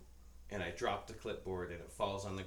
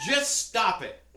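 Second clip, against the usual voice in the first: laughing plea; 14 semitones higher, 13 dB louder, slower than usual. Retold or imitated. Pleading with the clattering mess.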